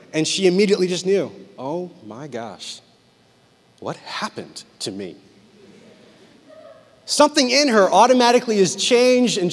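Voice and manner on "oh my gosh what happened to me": low, slow, hushed realization; acted dawning horror. Wife realizing she talks like her husband, acted hushed horror. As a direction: say it slowly and very softly, stunned.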